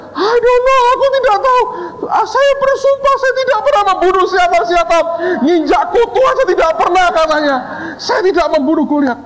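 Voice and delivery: high, loud, fast pleading; panicked denial. Retold or imitated. Terrified student pleading he killed nobody, high and frantic. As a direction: plead your innocence in a panic.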